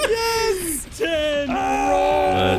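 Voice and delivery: high cheering yell; excited shout. Real, not acted. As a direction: celebrate like you just won. Crit roll celebration.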